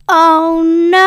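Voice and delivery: high, loud "Oh no!"; dismayed outcry. Retold or imitated. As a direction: cry out in sudden dismay. Aeneas finds Palinurus gone.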